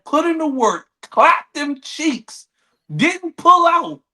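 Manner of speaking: slow, rising to a loud high shout; building outraged shout. Real, not acted. Ranting about the husband's baby, building to a shout.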